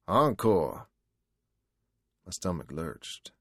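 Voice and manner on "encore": loud, high excited holler. Acted. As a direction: call it out across the bar.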